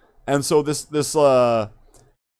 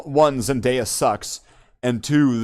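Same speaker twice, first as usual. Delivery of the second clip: ordinary talk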